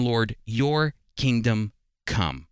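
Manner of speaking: ordinary talk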